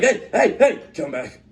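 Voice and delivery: high, loud "hey" calls; calling out. Retold or imitated. Staff call back Finn walking straight through, retold calling out. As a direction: shout after someone walking off.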